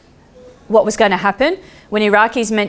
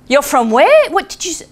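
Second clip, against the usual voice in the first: shocked disbelief; 7 semitones higher than usual. Retold or imitated. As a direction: ask it in amazement. Men react in shock.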